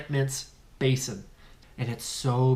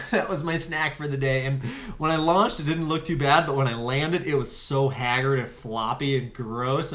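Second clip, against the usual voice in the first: ordinary talk, nothing marked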